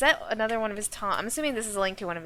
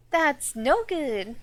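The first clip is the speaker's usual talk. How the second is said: slow, drawn-out higher taunt; game taunt; 4 semitones higher, 4 dB louder, much slower than usual. Retold or imitated. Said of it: "that's a taunt".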